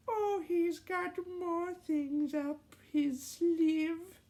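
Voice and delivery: put-on high, slow child voice; kid voice. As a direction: speak as a small boy. Ross kid voice.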